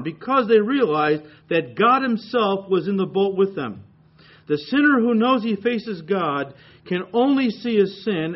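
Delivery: loud preaching read of a quote; reading a commentator's words aloud, preaching loud. Retold or imitated. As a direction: proclaim the quoted passage with force. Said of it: "He says, and I quote".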